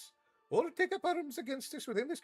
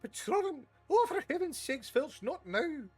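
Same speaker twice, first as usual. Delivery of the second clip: much higher, impatient snap; exasperated headmistress; 4 dB louder than usual. Acted. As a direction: swat away the interruption. McGonagall irritated at Filch.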